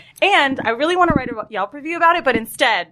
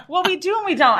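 higher prissy clerk voice; mock the evasive clerk; lightly voiced clerk, higher; imitating the saleswoman; 7 semitones higher than usual. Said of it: "She goes".

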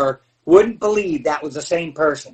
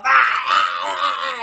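higher, yelped "ah, ah" in character; mock Gilbert shriek; 6 semitones higher, slower than usual. Throwing the tray down in catering, mock shrieking. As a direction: squawk like the goofy character.

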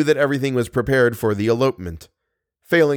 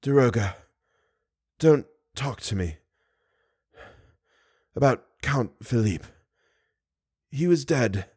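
soft, broken short phrases with pauses; weak and gasping; 4 dB quieter than usual. Acted. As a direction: fight for breath between words. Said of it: "speaking in short phrases and gasping for breath between the words".

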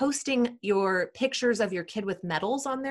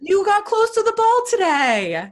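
much higher and louder, hammed-up cheering; over-the-top cheer; 13 semitones higher, 8 dB louder, slower than usual. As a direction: cheer the tiniest win like it's a championship. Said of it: "But you're like".

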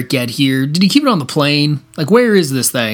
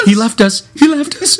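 imitating an abandoned girl, pitched high; little girl; 8 semitones higher, 3 dB louder than usual. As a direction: climb higher on the repeat, like a deserted child. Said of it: "the little girl's like".

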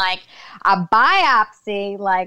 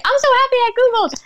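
much higher, fast, squealy mock delight; mock gushing; 4 dB louder, much faster than usual. Mocking a phrase nobody would ever say, in giddy fake delight. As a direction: gush like a giddy fan, rattling it off. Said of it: "you're probably never gonna hear anyone say".

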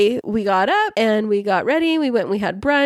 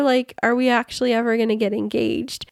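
ordinary talk, nothing marked

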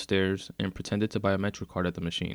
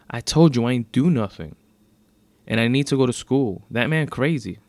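put-on loud, raised-pitch teen argument; exasperated protest; 4 semitones higher, 8 dB louder than usual. A narrator's reading. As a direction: insist indignantly that you are innocent. Teen argues innocence with officer.

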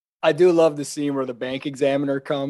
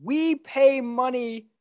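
mock lecture; 6 semitones higher than usual.

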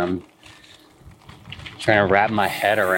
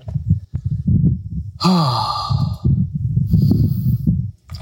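tired sigh; 7 semitones higher than usual. Real, not acted. Tired mid-ride, long exhale.

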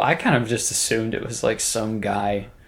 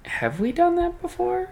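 surprised. Retold or imitated. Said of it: "And I'm like".

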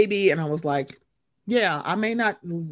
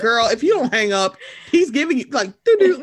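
rising louder sassy warning; mock warning; 3 semitones higher, 6 dB louder than usual. Podcaster warning a lost driver, rising sassy. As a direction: build it up to the doo-doo. Mocking phone GPS noise.

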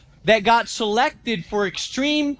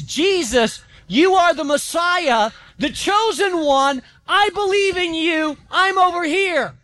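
higher, slow called-out plea; imitating yelling; 6 semitones higher than usual. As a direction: call out across a crowd. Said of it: "He was yelling the name of Jesus".

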